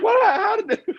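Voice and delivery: mock the stunned musician; shocked disbelief. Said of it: "You're like".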